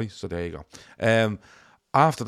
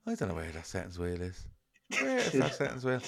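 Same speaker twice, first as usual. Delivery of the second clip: soft, much quieter self-talk; muttering to herself; 9 dB quieter than usual. Retold or imitated. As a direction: mutter it to nobody. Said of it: "she's talking to someone".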